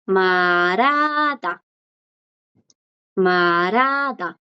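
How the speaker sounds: 말하다 stretched syllable by syllable. Shown as a demonstration. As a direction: draw out 말하다 for learners, long vowels. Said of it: "El verbo hablar es". (말하다 muy lento, alargando las vocales)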